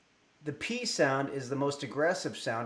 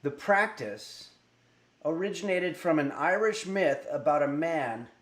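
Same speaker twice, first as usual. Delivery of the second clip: storytelling read; 4 semitones higher, 3 dB louder than usual. Acted. Reads jack-o'-lantern history.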